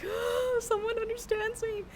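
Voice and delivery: high, teary, broken-up voice; tearful relief. Retold or imitated. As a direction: let relief come out as crying. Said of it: "And I'm like".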